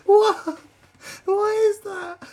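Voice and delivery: very high, loud, incredulous cry through laughter; laughing bafflement. Real, not acted. Reactor reacts to the outburst, baffled laughter. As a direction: squeal the question while cracking up.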